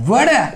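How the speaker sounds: American "water" with the t said as a d; American pronunciation. Shown as a demonstration. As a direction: say "water" as wada, final r dropped. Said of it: "American উচ্চারণ হচ্ছে টিটাকে ডি উচ্চারণ করে".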